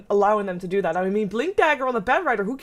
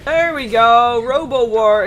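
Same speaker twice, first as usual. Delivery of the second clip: loud; 8 dB louder, slower than usual. Real, not acted. Caster calling fight outcome.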